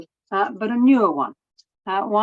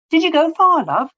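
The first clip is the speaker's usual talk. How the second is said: a light hint of a cheery dad, higher and louder; fond dad; 11 semitones higher, 7 dB louder than usual. Father greeting his daughter coming home, lightly voiced dad.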